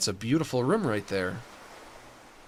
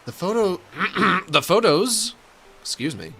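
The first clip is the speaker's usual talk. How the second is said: put-on pirate voice reading out instructions; pirate-talking game character; 5 semitones higher than usual. Game character tells the decorator to take photos, pirate voice. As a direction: read it as a hearty pirate.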